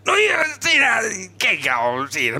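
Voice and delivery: a strained, pressed impression of a man, jumping high then slowing down; imitating Jukka Virtanen. Storyteller voices the footballer handing over the shoe, with a strained mock voice. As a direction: squeeze the words out as if pushing hard. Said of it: "Sä kuulostit enemmänkin siis siltä, että sä yrität imitoida Jukka Virtasta".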